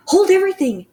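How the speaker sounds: high, loud shriek like a trumpet. Acted. Witch halting the assembly with a loud shriek. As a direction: blast it across the ballroom. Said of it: "Her shrieking voice echoed".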